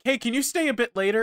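lightly voiced boss asking a favour; coworkers' request. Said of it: "They were like".

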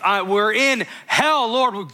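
high, urgent cry to God. Acted. Israelites in hell calling on the Lord, crying out. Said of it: "They're crying out".

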